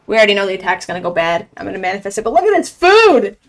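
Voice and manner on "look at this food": excited. Real, not acted. Showing off his meal.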